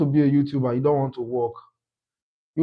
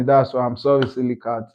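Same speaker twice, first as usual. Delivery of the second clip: ordinary talk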